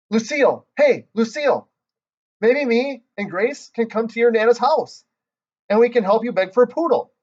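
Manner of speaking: bursting with excitement; excited calling. Acted. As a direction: call out a brand-new idea. Junie calls out a great idea.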